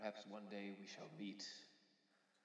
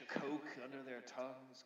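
ordinary talk

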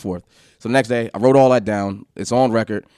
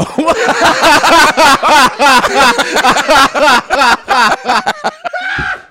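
high, loud, sustained laughing; long laugh; 10 dB louder than usual. Real, not acted. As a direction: burst out laughing and keep it going. Long laugh during the story.

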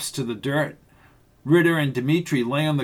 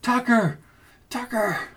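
high desperate calls; calling out; 9 semitones higher than usual. A narrator's reading. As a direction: call his name across the car. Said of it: "she called out for Tucker".

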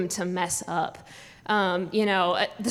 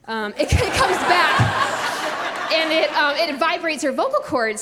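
higher, slow, laughing; 7 semitones higher, 4 dB louder than usual. Real, not acted. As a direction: giggle through the words. Laughing, someone arrives.

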